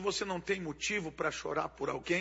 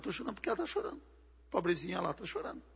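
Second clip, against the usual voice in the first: softer, fast, pitying mock voice; imitating a tearful woman; 8 dB quieter, much faster than usual. Preacher mocks a woman crying for no reason, soft and fast. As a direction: gush it softly like a silly sympathetic woman.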